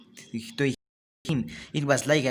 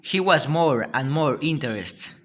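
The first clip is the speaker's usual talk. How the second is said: ordinary delivery, nothing marked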